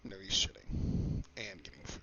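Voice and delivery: hushed whispered aside; conspiratorial whisper about the bathroom. Real, not acted. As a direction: whisper it so he can't hear.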